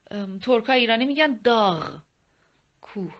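ordinary talk, nothing marked

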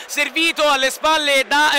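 usual speaking voice

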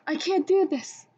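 mild panicked plea; mild yell. A narrator's reading. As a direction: call a halt in alarm. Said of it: "Sam yelled".

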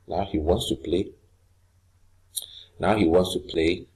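ordinary talk, nothing marked

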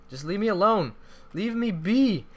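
high, loud begging to be left; pleading panic. Real, not acted. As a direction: beg them to back off. Being chased by players.

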